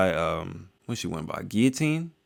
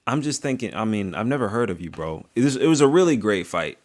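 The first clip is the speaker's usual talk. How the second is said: normal talking voice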